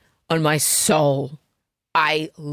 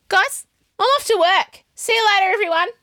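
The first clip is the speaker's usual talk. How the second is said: higher, louder sing-song goodbye; chirpy sign-off; 13 semitones higher, 4 dB louder than usual. Pretend. Host pretending to leave for her new office, bright farewell. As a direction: announce your exit like a cheery office worker.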